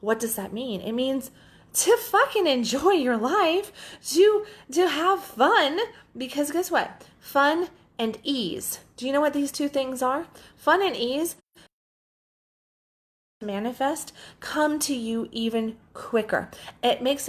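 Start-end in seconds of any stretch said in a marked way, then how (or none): 1.7-11.4 passionate outburst. Real, not acted. Coach urging listeners to enjoy life, high and emphatic. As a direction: sell the joy of having fun.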